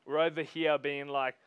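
normal talking voice

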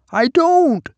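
put-on high, thin reedy old man. A narrator's reading. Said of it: "he agreed in a reedy voice".

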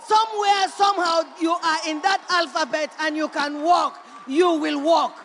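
shouted, building sermon climax; loud congregation-rousing preaching; full-force preaching. Acted. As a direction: preach it loud and rising to the last line.

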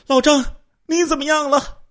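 high, loud call to the next room; anxious call. Acted. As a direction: call out to her husband in the bedroom. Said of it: "他赶紧就问了一句". (焦急地高声呼喊)

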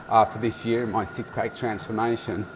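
ordinary talk

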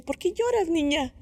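put-on high old lady's voice asking gently; little old lady. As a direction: voice the old woman, pitched well up. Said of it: "una viejita ... me dice". (voz aguda de viejita que pregunta con cariño)

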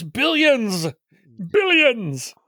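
hammed-up cry of a lost fortune; mock grandiose. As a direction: exaggerate the huge sum, stretching it out. Joking about a lost fortune.

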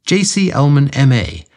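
ordinary delivery, nothing marked

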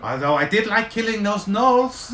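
high, gleeful little gnome; a gnome savoring the fight. Acted. GM voices Zanlin.

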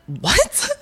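high, rising shocked question; mock shock. Guest imitating mother hearing about the dungeon, mock shock.

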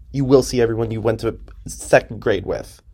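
usual speaking voice

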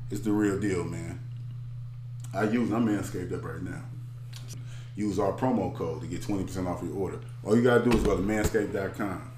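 ad read. Acted.

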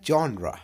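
जॉनरा said jawn-ra with ra at the end; जॉनरा, ending on a clear ra; correct genre. Shown as a demonstration. Teacher models जॉनरा, ra ending. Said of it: "real pronunciation होता है".

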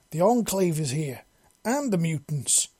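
a half-shouted warning over the radio. A narrator's reading. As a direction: yell through a radio, held back. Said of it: "Her voice quiet yell".

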